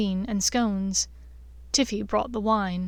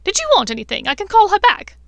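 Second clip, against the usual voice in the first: shrill mad witch voice; 10 semitones higher, 10 dB louder, faster than usual. A narrator's reading. Bellatrix offers Draco something.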